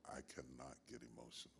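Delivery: low, hushed and quick; quiet restraint. Real, not acted. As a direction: say it softly, keeping feelings in check. Holding back his own emotion.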